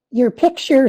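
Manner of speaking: ordinary delivery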